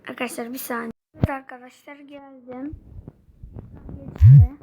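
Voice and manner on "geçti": excited cry. Real, not acted. Ball goes past in game.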